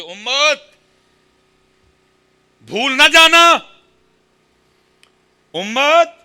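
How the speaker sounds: high, very loud proclamation; full-force preaching. Acted. Preacher voices Hazrat Umar addressing the Ummah, thunderous proclamation. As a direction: call out to the whole nation.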